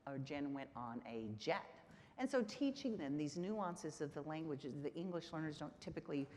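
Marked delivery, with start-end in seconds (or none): 0.2-1.7 jet held back and leaned on at the end; teacher's demo emphasizing jet; stress on jet. Shown as a demonstration. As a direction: land the emphasis on the last word, jet. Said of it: "look at the different emphasis".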